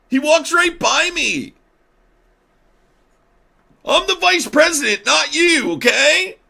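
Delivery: very high, shouted tattletale tantrum; J D Vance. Acted. Vice president tattling on rival to Dad, mock tantrum. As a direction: wail loudly like a kid telling Dad.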